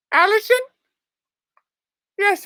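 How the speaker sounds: usual speaking voice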